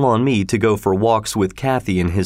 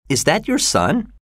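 higher-pitched, inquisitive question; curious; 6 semitones higher than usual. A narrator's reading. Kathy asking Heathcliff about the boy, curious. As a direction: let the pitch lift with curiosity. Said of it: "Kathy was asking Heathcliff curiously".

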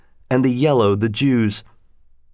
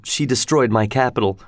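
ordinary delivery, nothing marked

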